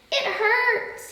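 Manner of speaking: a trapped lion calling out in pain; crying out in pain. A narrator's reading. Lion caught in the hunters' net, pained cry. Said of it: "he cried".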